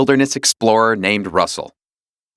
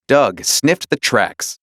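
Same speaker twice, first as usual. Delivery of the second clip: plain reading voice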